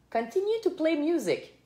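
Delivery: high-pitched command in French-accented English; English with a French Canadian accent, pitched high. Real, not acted. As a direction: give the voice assistant an order in Québécois-accented English. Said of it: "elle aime pas mon accent ou mon anglais".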